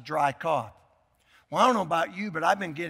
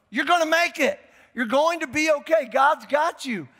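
higher and louder, fervent assurance; encouraging; 8 semitones higher, 4 dB louder than usual. Real, not acted. Pastor urging viewers to encourage each other, fervent. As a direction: lift them up with conviction. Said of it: "Look at somebody ... type it to 'em".

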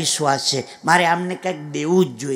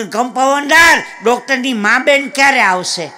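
loud demand; 5 semitones higher, 6 dB louder than usual. Retold or imitated. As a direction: bellow the question, drawing it out. Man shouting at clinic counter.

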